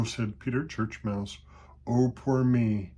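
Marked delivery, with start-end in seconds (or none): 1.9-2.9 woeful. A narrator's reading. Mouse dreads the coming cat.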